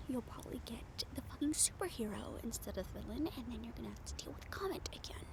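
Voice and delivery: hushed self-talk while waiting; whispering to herself. Acted. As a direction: whisper it so no one hears. Alone on rooftop talking to self.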